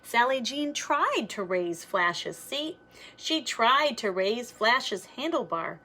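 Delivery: usual speaking voice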